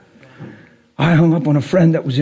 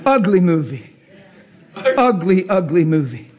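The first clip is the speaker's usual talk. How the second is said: normal talking voice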